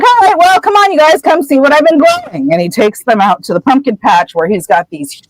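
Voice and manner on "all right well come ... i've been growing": high, hearty call, much higher than usual; hearty Hagrid. Retold or imitated. Hagrid calling the kids to see his pumpkins, high and hearty. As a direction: boom out a cheerful invitation up high. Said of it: "And then he's like".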